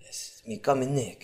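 hushed and muffled; low hushed phone voice; muffled quiet voice. Pretend. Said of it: "muffled tones".

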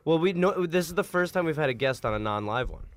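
ordinary talk, nothing marked

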